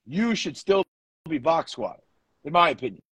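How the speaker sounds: normal talking voice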